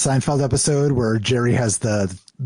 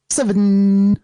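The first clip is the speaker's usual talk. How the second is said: emphatic host call; 7 semitones higher, 5 dB louder than usual. Acted. Show intro count.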